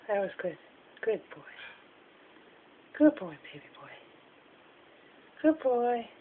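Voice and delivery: barely audible, soft whisper of praise; tender hushed whisper to a pet. Real, not acted. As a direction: whisper gently so as not to startle the bird.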